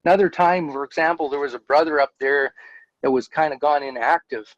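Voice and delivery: ordinary talk, nothing marked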